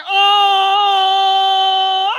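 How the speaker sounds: long, loud, high "oh" of shock; shocked outcry. Retold or imitated. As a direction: wail it out in mock horror, drawn out. Shocked reaction to being drugged.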